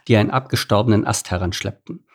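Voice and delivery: usual speaking voice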